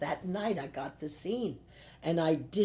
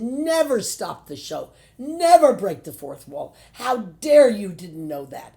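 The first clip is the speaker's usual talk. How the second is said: furious director; 4 semitones higher, 12 dB louder than usual. Retold or imitated. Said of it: "He said".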